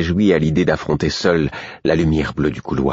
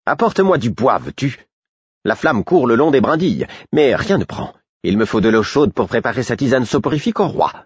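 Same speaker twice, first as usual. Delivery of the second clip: raised, impatient order; irritable Murfess; 6 semitones higher than usual. Acted. Murfess ordering the fool to fetch wood, impatient. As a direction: order the fool about testily.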